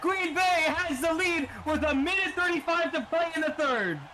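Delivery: high shouted goal call; excited goal call. Real, not acted. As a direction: scream the goal like a hype caster. Goal call in live commentary.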